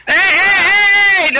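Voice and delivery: sharp high shout of hey; loud shout. Real, not acted. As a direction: stop them with a loud high call. Calling out to stop the kids.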